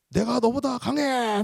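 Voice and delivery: high, drawn-out boastful cry; mock demon yell. Demon boasts to the exorcist, high mock yell. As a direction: imitate a demon yelling a boast. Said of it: "막 소리를 질러요".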